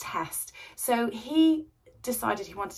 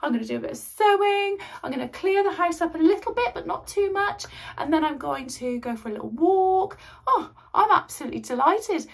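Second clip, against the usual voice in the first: gasping, much higher and louder glee; delighted; 9 semitones higher, 5 dB louder than usual. Retold or imitated. Wife gleefully telling of her day alone, excited. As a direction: gush about the free day with mock delight. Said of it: "But I'm like that".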